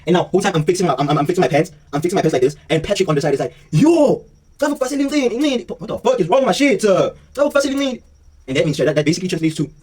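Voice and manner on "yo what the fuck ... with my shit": imitating a friend's outraged yell; mock yell. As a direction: shout it like the riled-up friend. Patrick yelling at the scene.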